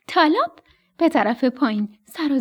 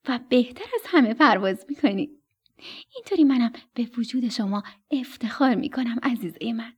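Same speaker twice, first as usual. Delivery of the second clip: usual speaking voice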